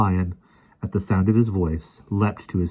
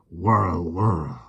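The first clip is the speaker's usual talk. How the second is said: lion growl. A narrator's reading. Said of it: "the lion growled".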